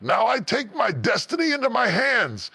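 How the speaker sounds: high-pitched, impassioned rallying roar. Acted. The strong version answers the weak one's doubts, roaring high. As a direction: roar it out high as a triumphant declaration. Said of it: "the strong version will roar".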